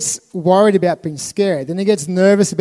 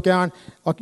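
ordinary talk, nothing marked

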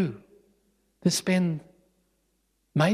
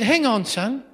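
higher-pitched, mild indignation; lightly acted cross dad; irate father; 6 semitones higher, slower than usual. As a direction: pull your child up in annoyance. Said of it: "starts to get really irate and really upset".

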